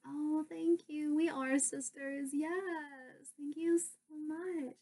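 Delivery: slow, high, gushing thanks; imitated flattered mom. Mom told they look like sisters, flattered. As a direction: coo with drawn-out delight, imitating mom.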